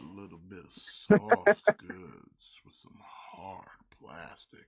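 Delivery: slow, whispered seductive tone; breathy, teasing whisper; sexy whisper. Pretend. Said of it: "talking in a sexy voice".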